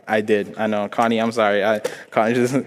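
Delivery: ordinary talk, nothing marked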